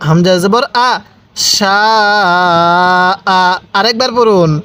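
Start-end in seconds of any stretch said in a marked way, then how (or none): 1.5-3.4 "সা" held very long, then a short "আ"; long madd. Shown as a demonstration. Teacher shows the four-alif rule, long drawn-out "সা" then short "আ". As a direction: stretch "সা" four counts before cutting to "আ". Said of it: "আমার সাথে সাথে টানটা দিবেন".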